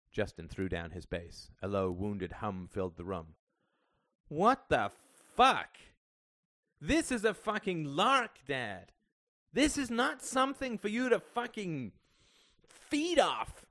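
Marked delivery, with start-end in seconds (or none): 4.3-13.7 loud, high-pitched angry shouting; explode, hitting each phrase hard; furious outburst. A narrator's reading. Son blowing up at his father over money, furious.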